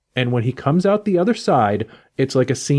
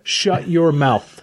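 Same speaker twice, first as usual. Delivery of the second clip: mock outrage; 5 semitones higher, much slower than usual. Mock outrage at never seeing movie.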